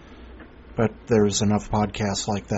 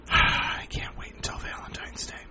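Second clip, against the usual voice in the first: sarcastic whisper; 8 dB quieter than usual. Real, not acted.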